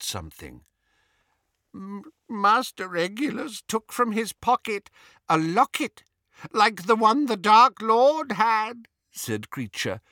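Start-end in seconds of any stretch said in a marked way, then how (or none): 1.7-8.9 high, stammering tearful elf voice; tearful house-elf. A narrator's reading. Elf telling Harry about his master's locket, tearful. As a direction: stretch the words out through tears. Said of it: "tears pouring down either side".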